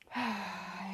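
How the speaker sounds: long, soft exhaled sigh; breathy release on a long exhale; demonstrated sigh. Shown as a demonstration. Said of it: "Exhale, sigh it out".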